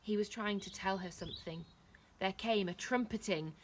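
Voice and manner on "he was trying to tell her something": usual speaking voice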